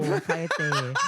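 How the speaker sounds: laughing shush. Pretend. Telling someone to shut up again.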